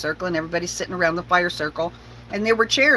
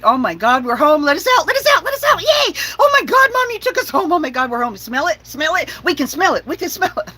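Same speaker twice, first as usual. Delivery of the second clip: high, squealing overjoyed dog voice; excited dogs; 8 semitones higher, 4 dB louder, faster than usual. Retold or imitated. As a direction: squeal it like dogs who just smelled home. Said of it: "they started going crazy".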